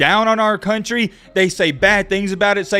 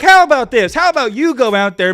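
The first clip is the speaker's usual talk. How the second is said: heated retort; 7 semitones higher, 5 dB louder than usual. Real, not acted. Ranting retort.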